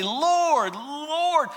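high, loud cry of Lord; pleading. Retold or imitated. Believers crying out to Jesus, high pleading cry. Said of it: "these people plead and say".

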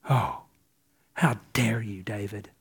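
a whispered how dare you, breathy and low; hushed rebuke. Acted. Michal hisses her scorn at David in a whisper. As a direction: hiss the rebuke under your breath.

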